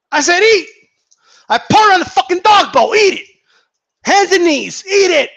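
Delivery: hammed-up drill sergeant; mock command. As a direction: order them down to the dog bowl. Mock ordering fans to eat words.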